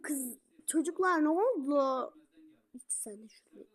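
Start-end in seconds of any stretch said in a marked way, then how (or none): 2.7-3.7 much softer, sneaky whisper; hushed "İç sen de şunu". Acted. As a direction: slip it in under the breath. Sneaky aside urging a drink.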